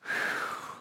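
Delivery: long soft breath out; demonstrated calming breath. Shown as a demonstration. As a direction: breathe out slowly to calm a child. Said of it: "just breathe in with me".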